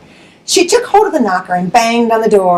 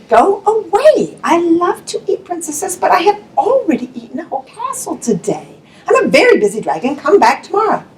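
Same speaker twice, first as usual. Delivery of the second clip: a grand dragon shooing her off, higher; 6 semitones higher than usual. Acted. Dragon voice in read story.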